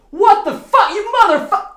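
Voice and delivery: very high, very loud screamed curse; furious yell. Retold or imitated. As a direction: scream it like you've been rudely woken. Roommate yelling at slammed door.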